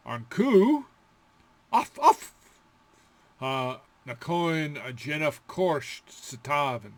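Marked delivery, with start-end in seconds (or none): 1.7-2.3 loud high mock barks; high yappy dog bark. Shown as a demonstration. Teacher barking like a dog, high and loud.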